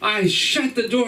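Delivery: normal talking voice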